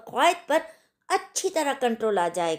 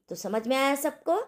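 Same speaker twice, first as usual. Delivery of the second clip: ordinary talk, nothing marked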